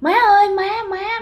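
high, imitated cry after a departing mother; retold calling out. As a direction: call out as she drifts away. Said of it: "thì mẹ kêu".

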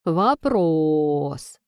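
long drawn-out taunting word; mocking drawl. A narrator's reading. Friend taunts the heroine, mocking drawl. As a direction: stretch the word out with mockery. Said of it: "с издёвкой протянула подруга".

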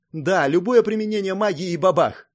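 lively and emphatic; animated. A narrator's reading. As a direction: act out the bang with gusto. Princess mimes an explosion.

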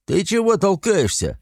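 high, indignant yell; indignant shout. A narrator's reading. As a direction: shout it, outraged. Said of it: "возмущённо закричал".

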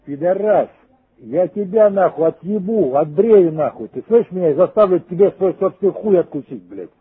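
usual speaking voice

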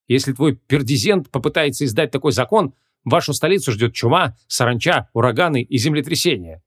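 ordinary delivery, nothing marked

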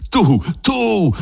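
high, raging bellow. Acted. Don Rodrigo bellowing at Griso. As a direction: roar at the traitor. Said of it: "mugghiava Don Rodrigo".

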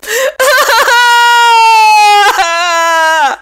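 long, very high bawling wail; loud high-pitched howl of tears. A narrator's reading. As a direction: burst out in a drawn-out squealing cry. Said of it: "собираться в плаксивую гримаску".

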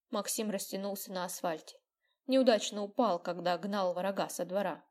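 ordinary delivery

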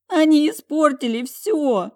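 high, loud, drawn-out wail; bawling. A narrator's reading. Talia weeping over ruined belongings, bawling. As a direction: wail it out as if crying hard. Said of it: "снова заревела она".

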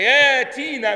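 "ياتينا" with long aa, no glottal stop; hamza turned to alif. Shown as a demonstration. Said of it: "مش هنقول".

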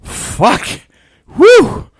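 usual speaking voice

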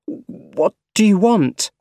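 slow, high-pitched alarmed question; halting, voice jumping up in alarm. A narrator's reading. Harry pushed down by Doc Ock, alarmed.

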